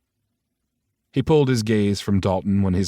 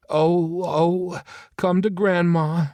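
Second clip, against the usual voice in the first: high, slow cooing grandma voice; 9 semitones higher, slower than usual. A narrator's reading. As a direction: coo over the newborn baby. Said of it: "Leslie cooed".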